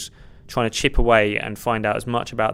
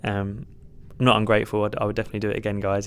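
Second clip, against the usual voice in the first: usual speaking voice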